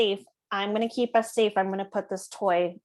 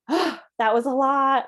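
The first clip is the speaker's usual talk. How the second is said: big exhale, a bit higher and louder; overwhelmed sigh; 5 semitones higher, 6 dB louder than usual. Acted. Parent after challenging behavior, sighing out frustration. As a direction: let it out like a drained parent. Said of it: "needing to take a deep breath".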